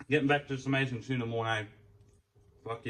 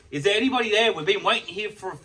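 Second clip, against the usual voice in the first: calling out; 6 semitones higher, 6 dB louder than usual. Retold or imitated. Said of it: "even I called out".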